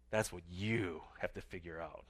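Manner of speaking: put-on low, soft grumpy old cowboy; low, raspy cowboy. Curly answers the question of the one thing, low and quiet. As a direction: lightly play the cowboy, dropped low and quiet. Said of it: "in his kind of low, raspy voice".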